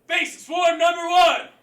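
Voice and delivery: slow, loud formal announcement; formal call. Real, not acted. Instructor opens the form for the students: slow, loud formal call. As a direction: call out the form name with ceremony.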